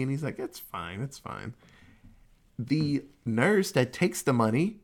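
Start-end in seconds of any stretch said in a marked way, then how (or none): 0.4-1.5 much softer, brushing it off gently; a quiet, understated it's fine; soft reassurance. Retold or imitated. Father brushing off daughter's apology.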